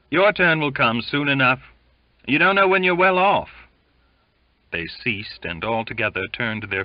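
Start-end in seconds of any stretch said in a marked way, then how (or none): 0.1-3.5 high, loud rebuke building up; loud scolding. A narrator's reading. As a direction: scold him, rising to a high shout. Crew calling out to grumbling shipmate.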